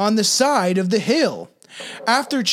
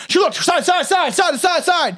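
frantic; 9 semitones higher, 3 dB louder than usual. Acted. Acting out frantic looking around.

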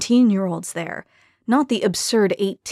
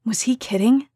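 ordinary delivery

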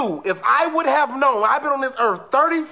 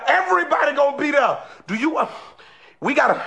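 ordinary talk

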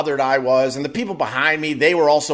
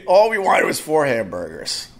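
ordinary delivery

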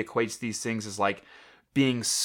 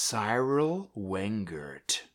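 spooky name reveal; 2 semitones lower, 5 dB quieter, much slower than usual. Pretend. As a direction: draw the name out ominously. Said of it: "get ready for this spooky name".

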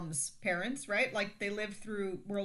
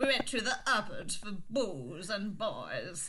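slow, lower mock old lady voice; 4 semitones lower, slower than usual. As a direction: croak it out like a hammy old woman. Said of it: "her doing her best with the old lady voice".

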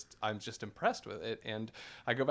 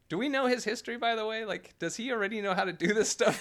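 high, incredulous question to himself; mock amazement; 10 semitones higher than usual. Telling his podcast guest how he reacted, high mock amazement. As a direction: play up disbelief at a newcomer's skill. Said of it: "I'm like".

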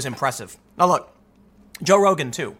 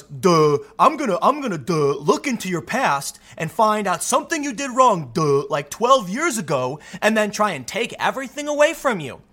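dumb Trump impression; 3 semitones higher than usual. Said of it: "He says he's gonna do an impression".

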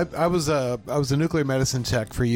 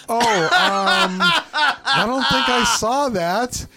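higher, louder, slow guilty denial with a laugh; nervous fib; 9 semitones higher, 5 dB louder, much slower than usual. Retold or imitated. Tech lying to ICU nurse about throwing the piece away, nervously laughing. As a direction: fake innocence while laughing.

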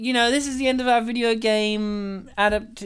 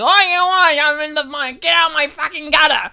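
high, startled yelp; flustered shout; 7 semitones higher, 6 dB louder than usual. Retold or imitated. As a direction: act startled and shooed off. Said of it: "And we're like".

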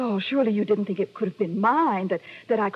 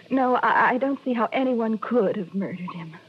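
ordinary talk

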